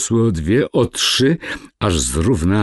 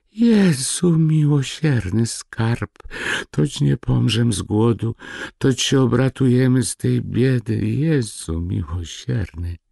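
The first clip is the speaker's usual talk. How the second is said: overjoyed exclamation; 7 semitones higher than usual. A narrator's reading. Woman rejoicing over found money.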